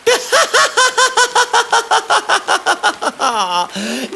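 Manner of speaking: high scornful cackle; mocking laugh. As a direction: laugh him off, high and long. Pharaoh mocks Moses.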